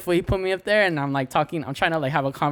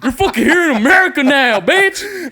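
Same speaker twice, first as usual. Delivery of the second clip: loud, very high, laughing rant; 11 dB louder than usual. Retold or imitated. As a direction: ham up an ugly rant, giggling through it. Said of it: "Now you sound racist and shit".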